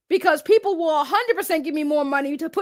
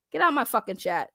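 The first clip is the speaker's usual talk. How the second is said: ordinary delivery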